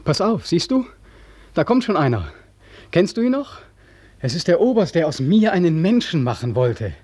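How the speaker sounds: feverish, high, agitated. Acted. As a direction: grab the listener's arm and point. Points out approaching colonel.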